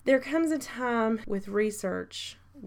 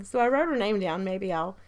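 ordinary delivery

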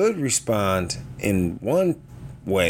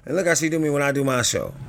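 ordinary talk, nothing marked

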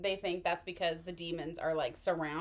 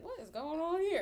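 puzzled; 8 semitones higher than usual. Retold or imitated. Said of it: "Sam notices it".

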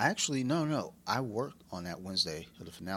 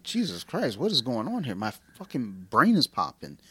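higher, re-enacted astonishment; amazed reaction; 4 semitones higher, 4 dB louder than usual. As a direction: act out your mind being blown. Retells own amazed reaction.

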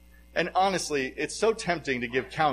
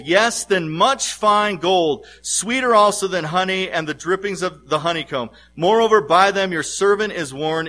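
preacher's psalm reading, building steadily louder; scripture reading; 6 dB louder than usual. Acted. Preacher reading the psalm to the congregation, building in force.

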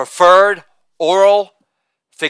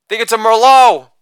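ordinary talk, nothing marked

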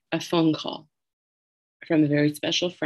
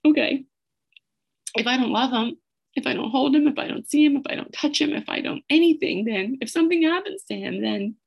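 higher, tearful voice building; choked up; 9 semitones higher than usual. Real, not acted. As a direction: hold back real tears while recounting it. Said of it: "that's so hard on me still".

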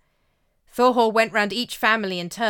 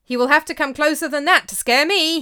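bright, carefree boast, higher and louder; cheerfully unafraid, raised voice; 8 semitones higher, 8 dB louder than usual. A narrator's reading. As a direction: laugh off the threat. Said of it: "he said cheerfully".

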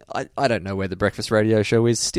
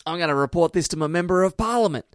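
quoted American tourist; 8 semitones higher than usual. Retold or imitated. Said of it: "what's the".

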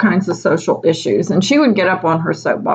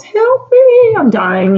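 very high, louder squeak; high mock mouse cry; tiny mouse voice; 5 dB louder, slower than usual. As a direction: pretend dying mouse pleading. Mouse note in drawer.

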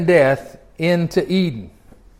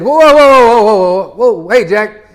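loud, high startled whoa; hollering at her to stop; playful hollered warning; mock alarmed shout; 4 semitones higher, 7 dB louder than usual. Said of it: "could he maybe have stepped in and said".

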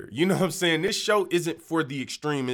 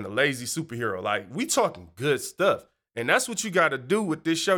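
normal talking voice

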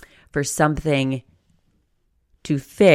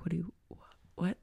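stunned; 4 semitones higher, 12 dB quieter, faster than usual. Retold or imitated. Imagined stunned reaction.

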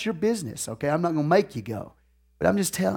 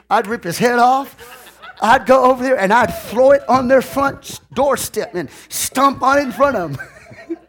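mock rage; 7 semitones higher, 4 dB louder than usual. Pastor acts out fury at decoration.

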